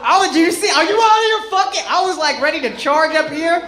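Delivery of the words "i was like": normal talking voice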